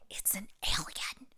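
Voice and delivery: breathy eager whisper; excited whisper. A narrator's reading. Little Wee Bear whispers about the stranger excitedly. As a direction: whisper it with thrill. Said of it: "whispered excitedly".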